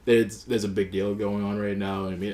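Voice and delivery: ordinary talk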